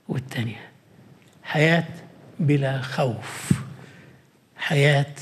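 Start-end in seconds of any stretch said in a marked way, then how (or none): 0.1-0.7 barely voiced whisper. Real, not acted. Preacher savouring the second verse, whispering. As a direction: hush the word down.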